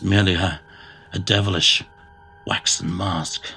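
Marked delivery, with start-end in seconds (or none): none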